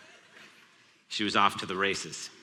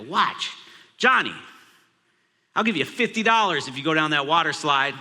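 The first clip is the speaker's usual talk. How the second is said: calling out; 5 semitones higher, 5 dB louder than usual. Retold or imitated. Dad calling son across pool.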